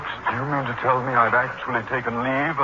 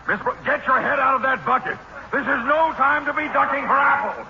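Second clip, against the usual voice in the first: much higher, urgent scolding call; agitated calling out; 13 semitones higher than usual. Acted. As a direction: pitch up in alarm and call her out of the bucket. Principal calling to woman in bucket.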